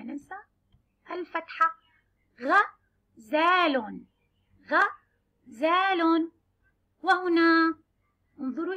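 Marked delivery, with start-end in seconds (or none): 2.4-6.3 غزال very slowly drawn out, each syllable held; slow sounding out. Shown as a demonstration. Teacher to children, drawing out غزال slowly. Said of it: "نختار حرف الغين مع فتحة".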